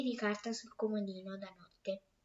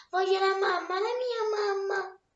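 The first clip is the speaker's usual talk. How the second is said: put-on upset little girl; 8 semitones higher, 5 dB louder than usual. Frightened girl wants mother.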